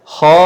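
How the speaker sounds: KHA held long and loud, with a heavy rounded vowel; long thick kha. Shown as a demonstration. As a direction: sustain a thick "kha" on a level note. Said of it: "Jadi kha itu panjang". (kha lantang, tebal, ditahan panjang)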